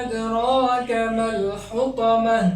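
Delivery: হুতামাহ ending on a sounded ha; stop on ha. Shown as a demonstration. Teacher reciting slowly to students, stopping on ha. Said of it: "এভাবে উচ্চারণ করতে হবে".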